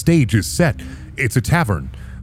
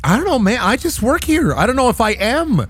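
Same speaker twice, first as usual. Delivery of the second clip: high, dodging figure; high arbiter voice; 10 semitones higher than usual. Acted. Arbiter dodging the hero's question, high squeaky voice. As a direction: shrug it off in a squeaky voice.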